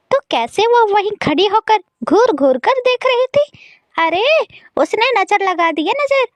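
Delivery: high, worked-up indignation; accusing stepmother. Acted. Mother blames the girl for her son's pain, accusing. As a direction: pin the blame in a high, fussing voice.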